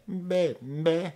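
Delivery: mimicking the sample's meh; Beaker meh. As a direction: bleat like the Muppet Beaker. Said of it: "there's this person saying".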